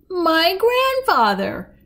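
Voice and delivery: put-on high, slow old-woman voice; reminiscing granny. A narrator's reading. Granny naming who was overheard, slow reminiscing voice. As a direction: draw it out from memory. Granny recalling her grandfather.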